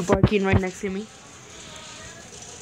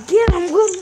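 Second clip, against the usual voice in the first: excited shout; 10 semitones higher, 8 dB louder than usual. Real, not acted. Excited shout during game fight.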